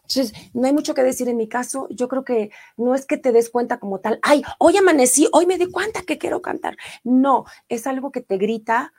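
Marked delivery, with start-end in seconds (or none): 4.2-6.8 imitación burlona, aguda y cantarina; mock naive quote. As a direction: se burla de quien dice descubrirlo de un día para otro. Mocks a naive singer's claim. (tono burlón y agudo)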